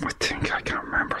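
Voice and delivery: hushed whisper aside. Real, not acted. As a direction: drop to a breathy whisper. Whispers aside mid answer.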